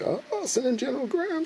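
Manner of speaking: very high cartoon voice; Lincoln deciding. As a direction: squeak out the order. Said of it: "And he's like".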